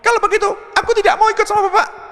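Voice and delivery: very high, fast whiny wife voice; hammed-up wife refusing to come along; sulking wife. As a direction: rattle it off high like a pouting wife. Sulking wife's acted line.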